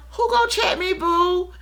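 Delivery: high, cocky "who gonna check me, boo"; sassy challenge. Retold or imitated. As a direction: dare anyone to call you out. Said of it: "I had them".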